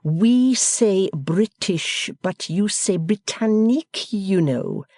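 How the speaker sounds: host forgiving the foreigner's word. Acted. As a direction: excuse his mistake graciously.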